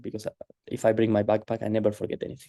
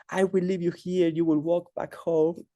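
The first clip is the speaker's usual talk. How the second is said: mild mock yell, pitched up; retold furious shouting; 7 semitones higher than usual. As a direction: imitate an angry guru laying down punishment. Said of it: "he starts shouting at me".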